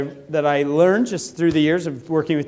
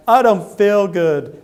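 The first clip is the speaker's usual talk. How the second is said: slow, higher whiny excuse; mock sick whine; 4 semitones higher, 4 dB louder, much slower than usual. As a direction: fake feeling sick, dragging it out. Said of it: "they're like".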